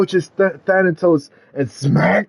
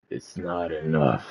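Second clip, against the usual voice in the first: very low, hushed menacing reply; smirking villain; 12 semitones lower, 11 dB quieter than usual. Acted. Thanatos answers Lewis Hendricks's plea, smirking and low. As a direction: drop it low and soft with a smirk. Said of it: "and he smirks".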